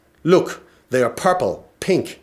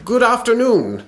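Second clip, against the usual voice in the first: high put-on magician greeting; chirpy storybook magician voice; kids party magician; 10 semitones higher, 4 dB louder than usual.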